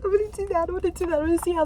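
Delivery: high, teasing repeat of her own promise; mocking echo. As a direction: parrot her own words in a squeaky mock tone. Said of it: "repeats own line mockingly".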